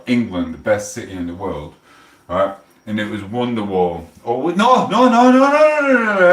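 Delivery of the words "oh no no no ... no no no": much higher, louder string of no; frantic no. Real, not acted. Correcting the song title, much higher shouting no. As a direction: shout no as you catch the mistake.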